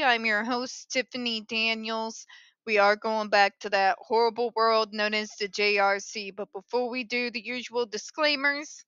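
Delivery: ordinary talk, nothing marked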